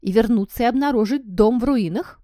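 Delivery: loud, high angry snarl; growled. A narrator's reading. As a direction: snarl it indignantly. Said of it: "прорычала она".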